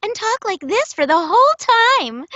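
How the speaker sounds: high, bright-eyed princess voice; sing-song princess talk; Disney princess voice. Acted. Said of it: "my Disney princess voice".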